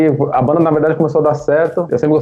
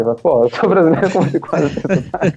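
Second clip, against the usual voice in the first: higher-pitched, laughing protest; mock indignation; 6 semitones higher than usual. Brazilian answering commenters about his accent, mock indignant and laughing. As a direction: protest in disbelief, breaking into laughter.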